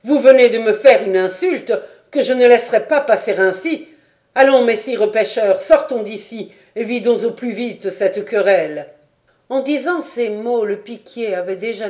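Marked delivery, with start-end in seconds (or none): 0.0-8.9 loud, furious call to fight; enraged challenge. A narrator's reading. Sergeant challenges the fishermen to a duel, furious. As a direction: demand the duel in a rage.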